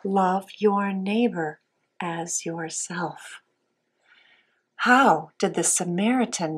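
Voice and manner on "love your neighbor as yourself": slow, soft recitation of the verse; slow memory verse. Shown as a demonstration. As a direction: say each word slowly for children. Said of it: "what God's Word says".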